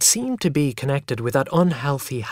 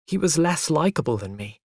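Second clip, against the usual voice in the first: usual speaking voice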